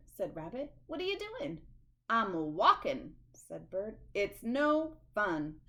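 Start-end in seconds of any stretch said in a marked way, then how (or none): 0.9-1.7 high, eager rabbit voice; a bouncy rabbit asking what's up; high, curious rabbit voice. Acted. 2.1-5.6 slow grumpy bird. Acted.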